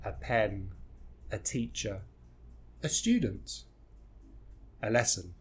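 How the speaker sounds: "a" as a soft, reduced "uh" joined to each noun; soft, separated phrases, weak "a" before the noun; linked schwa. Shown as a demonstration. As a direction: glide from "a" into the word. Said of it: "link it to another word".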